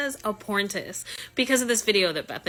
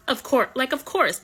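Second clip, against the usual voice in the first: higher and louder, building disbelief; exasperated of course; 6 semitones higher, 3 dB louder than usual. Real, not acted. Telling viewers Martha turned up again, higher louder exasperation.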